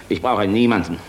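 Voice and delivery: loud, hard refusal; forceful rebuff. Acted. General loudly rejecting the woman's offer. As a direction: push her away with your voice.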